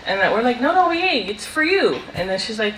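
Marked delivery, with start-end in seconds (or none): 0.6-2.0 higher, laughing insistence; mock protest. Grandchild insisting the watermelon is for grandma, higher mock protest. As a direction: politely push the food back to her.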